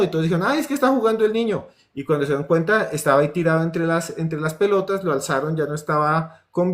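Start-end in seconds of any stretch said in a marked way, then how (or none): 0.4-1.7 despreocupación burlona. Retold or imitated. Adultos desestiman al niño.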